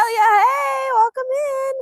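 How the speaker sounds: high, ringing singsong greeting; excited welcome. Real, not acted. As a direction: welcome a new arrival with high-pitched delight. Welcoming a viewer from Australia.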